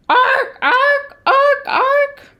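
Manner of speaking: loud, high barking squawks; jay squawk. A narrator's reading. Jay in the noisy recap, loud high squawking. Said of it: "The jay".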